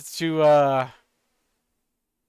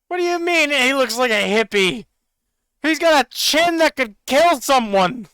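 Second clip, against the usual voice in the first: indignant excitement; 7 semitones higher than usual. Real, not acted. Defending the elf's chin, indignant.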